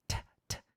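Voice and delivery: "t, t" sem voz, só o estalo; som de T sussurrado. Shown as a demonstration. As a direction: solte "t" seco e sem voz. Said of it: "Isso aqui não é t". ("t" sussurrado, só o estalo da língua)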